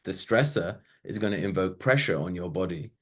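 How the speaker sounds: ordinary delivery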